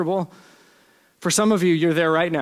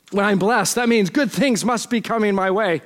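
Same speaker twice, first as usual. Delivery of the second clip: ordinary delivery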